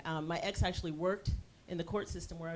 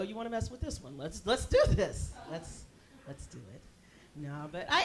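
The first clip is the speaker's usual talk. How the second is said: ordinary talk, nothing marked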